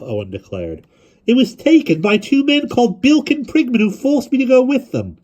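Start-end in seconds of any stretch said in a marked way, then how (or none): 1.3-5.2 put-on high, loud pleading boy; lightly voiced desperate boy; earnest boy. A narrator's reading.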